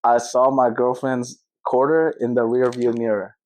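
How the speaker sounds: low, slow American reading; unhurried American delivery, pitched low; own American accent. Shown as a demonstration. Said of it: "You say it in yours".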